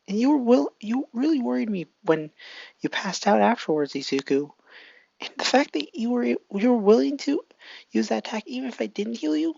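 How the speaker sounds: put-on high, slightly teary girl voice. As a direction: plead with him, voice lifted high. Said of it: "with tears in her eyes".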